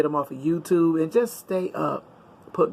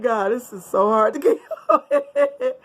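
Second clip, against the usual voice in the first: slow, higher, half-laughing sigh at it all; overwhelmed by it, laughing through the words; laughing exasperation; 6 semitones higher than usual. Retold or imitated. Vlogger sighing at social media work, laughing. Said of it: "I said".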